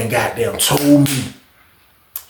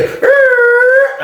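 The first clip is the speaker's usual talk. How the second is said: strange high grunt; 4 dB louder than usual. Retold or imitated. Odd noise heard from outside.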